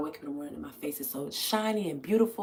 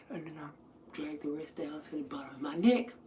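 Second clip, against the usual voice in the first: whisper; 5 semitones lower, 9 dB quieter than usual. Real, not acted. Application demo.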